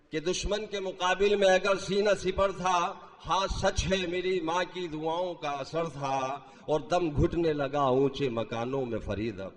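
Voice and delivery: declaimed Urdu verse; stately nazm recitation. Acted. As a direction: recite the couplet with grand feeling. Mushaira poetry recitation. (شاہانہ انداز میں نظم خوانی)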